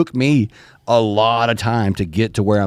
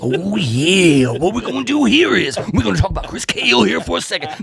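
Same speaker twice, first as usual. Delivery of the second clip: wrestling promo; 6 semitones higher than usual. Pretend. Wrestling promo banter continues.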